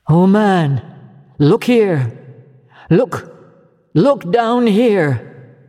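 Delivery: high ringing call to look; exclaiming. Acted. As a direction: cry out to the man, drawn out. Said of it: "exclaimed the ghost".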